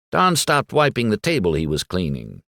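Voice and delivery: plain reading voice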